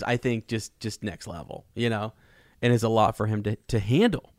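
ordinary talk

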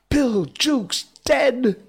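very high, slow shocked question; startled cry. A narrator's reading. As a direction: cry out in disbelief, pitch way up. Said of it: "cried the startled pirates".

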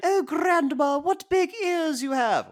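put-on high falsetto little-girl puppet voice; hammed-up fairy-tale girl, pitch way up.

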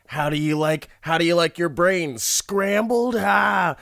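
loud, high, manic taunt; possessed man. Acted. Possessed man taunts about scrambled brains, hammy manic yell. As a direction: taunt them, building to a mock yell. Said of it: "like, possessed by something".

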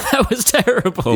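high-pitched, laughing through the words; amused, laughing verdict. Real, not acted. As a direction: crack up while saying it. Laughing at the bad accent.

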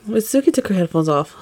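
ordinary delivery

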